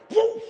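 preacher's loud dog bark; excited woof at the crowd. Real, not acted. Preacher barking at congregation.

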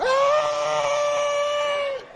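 sudden piercing high scream; high shriek. Retold or imitated. Big man finds the dead rat, screaming high. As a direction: let out a shrill startled scream. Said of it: "Everybody would hear something go".

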